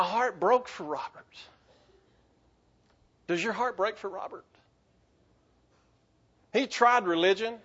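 quietly moved, holding back tears; emotional. Real, not acted. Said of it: "I'll probably get emotional".

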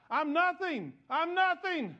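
high, loud, over-the-top wail; loud crying out. Retold or imitated. Youth pastor joining the altar, crying out loudly. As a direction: bellow it, outdoing the others. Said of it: "to cry out".

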